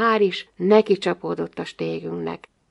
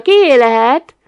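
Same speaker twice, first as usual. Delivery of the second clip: loud, raised mock-curious question; feigned wonder; 5 semitones higher, 10 dB louder, slower than usual. A narrator's reading. Narrator pretending not to have seen it, feigned wonder. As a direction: wonder aloud as if seeing it first. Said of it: "mintha az előbb nem láttam volna".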